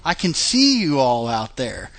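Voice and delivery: ordinary talk, nothing marked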